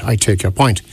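normal talking voice

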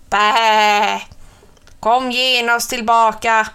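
bleat, then call the lamb back; a mother sheep calling her lamb back; bleating ewe. A narrator's reading. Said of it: "bräkte lammets mamma".

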